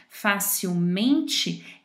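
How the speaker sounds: "facilmente" bem lento, sílaba por sílaba; facilmente devagar. Shown as a demonstration. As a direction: pronuncie "facilmente" devagar, destacando "mente". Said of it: "quando eu acrescento o sufixo mente". (professora soletra "facilmente" bem devagar)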